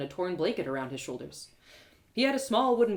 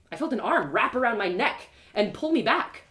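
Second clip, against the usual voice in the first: usual speaking voice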